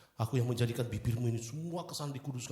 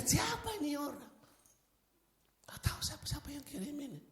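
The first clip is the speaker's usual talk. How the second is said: puzzled whisper; 6 dB quieter than usual. Retold or imitated.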